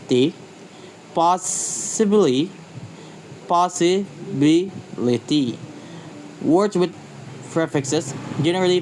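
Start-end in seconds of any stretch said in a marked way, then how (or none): 1.1-5.6 "possibly" and "possibility" slowly, syllable by syllable; stress demo. Shown as a demonstration. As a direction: break "possibly, possibility" into slow, separate syllables. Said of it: "The stress usually jumps to the syllable".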